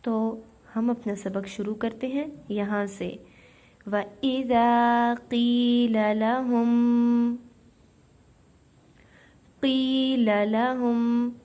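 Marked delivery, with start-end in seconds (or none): 3.9-11.3 slow, loud melodic tajweed chant; Quran recitation. Acted. Teacher recites for students in slow chant. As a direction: draw every syllable out like a reciter.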